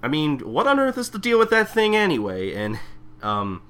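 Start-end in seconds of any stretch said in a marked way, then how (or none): 0.0-2.5 higher, louder reasoning Shinpachi voice; lightly voiced earnest sidekick; imitating Shinpachi. Retelling the episode to a friend as Shinpachi, earnest. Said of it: "Shinpachi's like".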